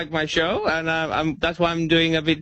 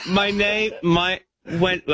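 ordinary delivery

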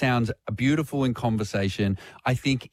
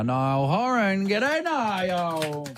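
high, drawn-out radio intro; big guest welcome; 7 semitones higher, much slower than usual. Real, not acted. Radio host brings on the great man, excited welcome. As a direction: roll out the name with excitement.

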